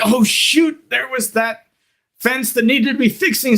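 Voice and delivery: high-pitched, lightly acted sudden realization; mock alarm. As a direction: remember an undone chore with mild panic. Rancher suddenly remembers broken fence in storm.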